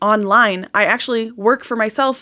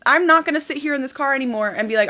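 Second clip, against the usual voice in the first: ordinary talk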